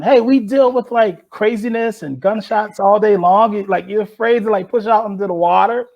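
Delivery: high, loud challenging yell. Retold or imitated. Urging scared teammates to push off, high loud yell. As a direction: call them out across the dock. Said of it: "I remember yelling".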